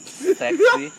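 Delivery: high flirty purr. Retold or imitated. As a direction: tease the cop flirtatiously. Flirting with police.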